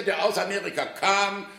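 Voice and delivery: ordinary talk, nothing marked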